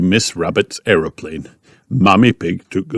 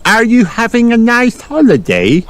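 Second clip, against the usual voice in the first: put-on high little-girl question; high storybook piglet; Peppa. A narrator's reading. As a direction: ask a friend brightly, pitched way up. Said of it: "called Peppa".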